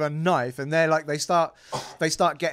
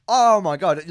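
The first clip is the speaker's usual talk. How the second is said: loud, higher exclamation of disbelief; mock disbelief; 6 semitones higher, 10 dB louder, slower than usual. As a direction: gasp it out in disbelief. Disbelief at price.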